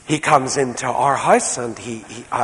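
ordinary talk, nothing marked

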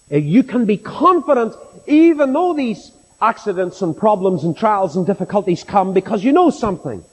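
ordinary talk

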